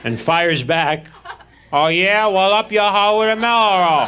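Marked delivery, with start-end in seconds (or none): 1.7-4.1 put-on high, loud nasal-free goofy kid voice, much higher; Horshack voice. Horshack taunts the new kid, high and loud. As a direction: ham up the schoolyard tough kid. Horshack fires back a rank.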